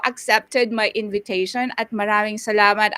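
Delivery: ordinary talk, nothing marked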